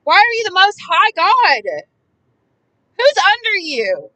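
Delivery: mad. Real, not acted. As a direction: demand it, slowed and outraged. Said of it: "I'm fast to get mad just reading it".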